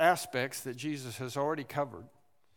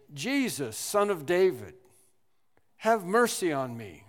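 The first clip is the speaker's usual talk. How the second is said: crying out; 3 semitones higher, 6 dB louder than usual. Retold or imitated. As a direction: call out to Jesus for mercy. Blind man crying out.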